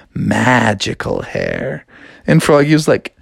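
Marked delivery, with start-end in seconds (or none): 0.1-1.9 dreamy princess. Acted. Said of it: "I have long".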